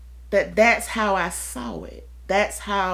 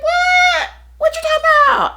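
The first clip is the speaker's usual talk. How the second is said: squeaky high, louder "what?"; mock surprise; 7 dB louder than usual. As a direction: play baffled, as if it's news. Confused reply to friend's complaint.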